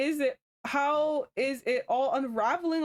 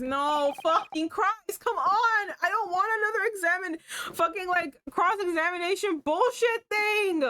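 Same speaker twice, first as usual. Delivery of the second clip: exasperated complaint, pitch climbing; venting at the game's tedious questioning; frustrated rant; 6 semitones higher than usual. Real, not acted. Irritated streamer ranting at the game, exasperated.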